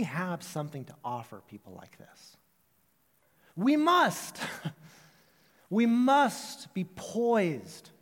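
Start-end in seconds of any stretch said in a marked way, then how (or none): 3.6-4.4 high, loud "must"; emphatic preaching. Real, not acted. Pastor urging the congregation to act. 5.7-7.9 "must" stretched, very slow and higher; slow it right down and lean on "must"; emphatic preaching. Real, not acted. Pastor pressing the church to be ready.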